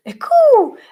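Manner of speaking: high, loud exclamation; mock outcry. Quoting what some people will say, mock outcry. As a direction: cry it out like an alarmed objector.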